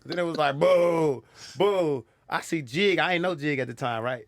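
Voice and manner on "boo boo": loud, higher drawn-out boo; raised-voice mock heckle; imitated crowd booing.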